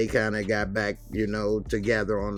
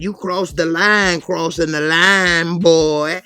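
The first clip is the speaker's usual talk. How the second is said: much higher, louder, slow put-on voice; mock angry cop; 8 semitones higher, 11 dB louder, slower than usual. Cop scolding Derek, loud and slow. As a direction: drag out the threat.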